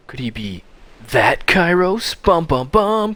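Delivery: high, loud, drawn-out reveal; hammed-up reveal with a sung sting; mock suspense. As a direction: announce it like a dramatic cliffhanger.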